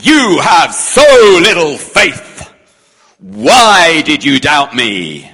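loud, slow stern telling-off; harsh rebuke. Shown as a demonstration. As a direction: scold him as a disappointed master. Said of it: "Do we read it as".

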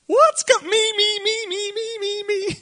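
whiny high chant of me, me, me; mock me me me. Preacher mocking the disciples' grab for honor, high whiny chant. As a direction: squeal me me me like a greedy child.

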